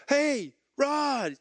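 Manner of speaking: high bright call across the shower. Retold or imitated. Friend calling out to him in the shower, cheery shout. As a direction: call his name over the water.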